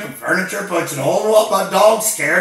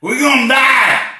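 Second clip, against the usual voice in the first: loud, higher cry of doom; panicked yell; 7 semitones higher, 5 dB louder than usual. Acted. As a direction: scream it in mock terror. Caller panicking on hotline.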